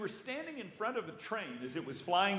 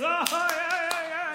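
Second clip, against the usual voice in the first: much higher, louder knowing yeah; hearty dean; 12 semitones higher, 8 dB louder than usual. Retold or imitated. The dean hugs him, hearty knowing yeah. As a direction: burst out laughing in agreement.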